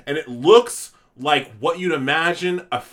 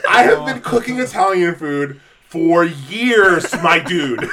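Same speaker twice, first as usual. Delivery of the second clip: louder, laughing, slow exasperation; laughing indignation; 3 semitones higher, 7 dB louder, slower than usual. Real, not acted. Answering pasta critics, loud laughing indignation. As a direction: laugh through the outrage at the critics. Ranting back at commenters.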